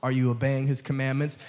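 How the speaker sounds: ordinary talk, nothing marked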